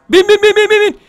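high, rapid chant of "bin"; frantic high urging. Real, not acted. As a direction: pitch way up, hurry the character onto the bike. Yelling at the character to get on.

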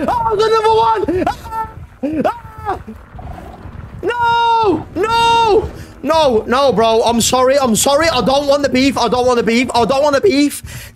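high, breathless begging; pleading for mercy, scared stiff; frightened yelps and pleas; real panic. Real, not acted. Another monster appears, pleading.

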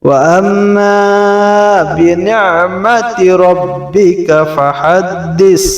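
high, melodic chanted recitation of the surah; Quran recitation. Acted.

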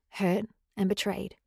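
usual speaking voice

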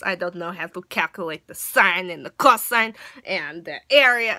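loud ranting in a mock Russian accent. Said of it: "Russian Gracie in and have her rant".

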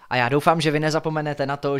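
plain reading voice

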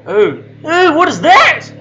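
high, loud startled question; alarmed. Real, not acted. Player spots something creepy, alarmed and loud. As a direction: jolt at something unseen.

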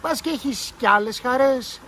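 scornful girl asking mockingly; ironic question. A narrator's reading. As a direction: tease him with a sarcastic lilt. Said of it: "ρώτησε ειρωνικά η Τάνια".

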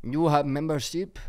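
a light mock Italian accent. Asked to do the doorman in his Italian accent. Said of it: "do it in his accent".

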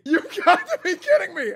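high, loud, laughing in disbelief. Real, not acted. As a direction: crack up in total disbelief. Disbelief at meme winner.

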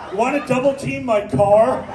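ordinary delivery

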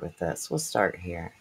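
whispered softly; hushed. Real, not acted. Streamer easing viewers into the topic, whispered. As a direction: drop to a whisper before beginning.

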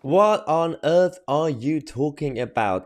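exasperated, drawn-out incredulity; incredulous. Real, not acted. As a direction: stretch out the question in bafflement. Host baffled by guest's remark.

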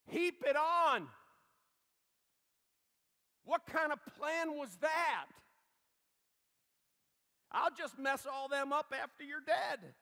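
loud, high, slow jeering shout; gloating Satan. Acted. Satan gloating at the cross, shouting high and loud. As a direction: crow over the victim. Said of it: "Satan is going".

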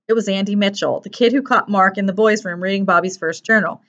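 usual speaking voice

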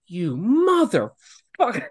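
slow, high, drawn-out mock curse; half-laughing fake fury at a buddy; mock outrage. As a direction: stretch the insult out in disbelief. Learns the broken arm was fake.

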